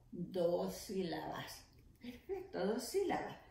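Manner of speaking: ordinary talk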